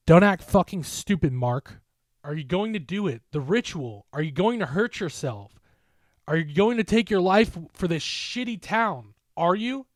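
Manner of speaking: tearful furious shouting, pitch climbing; angry shout. A narrator's reading. Jen screams at Mark not to hurt himself, angry yell. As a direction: yell at him through tears. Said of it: "she continued to shout at me".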